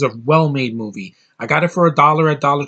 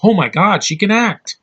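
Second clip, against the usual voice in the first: higher, louder burst of amazement; amazed exclamation; 6 semitones higher, 4 dB louder than usual. Retold or imitated. Viewer seeing an actress shine in a film, retold amazement. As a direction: exclaim it like a sudden revelation. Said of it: "and I'm like".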